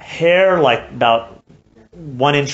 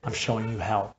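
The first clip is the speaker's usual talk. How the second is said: a quiet, grave voice from a vision; very quietly; 2 semitones lower, 8 dB quieter than usual. Retold or imitated. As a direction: say it softly, almost under the breath. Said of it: "he told me very quietly".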